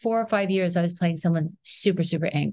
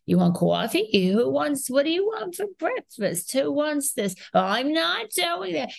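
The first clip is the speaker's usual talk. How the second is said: put-on Queens accent; 8 semitones higher than usual. Mother in character offering her kids breakfast, Queens accent. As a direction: play a Queens woman, pitched way up. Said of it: "on purpose characters like that".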